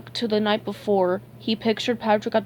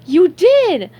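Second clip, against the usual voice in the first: high, loud outburst; loud cartoon exclamation, pitched up; excited cry; 9 semitones higher, 11 dB louder than usual. A narrator's reading. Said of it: "SpongeBob cried".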